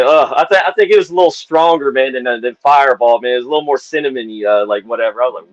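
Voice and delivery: normal talking voice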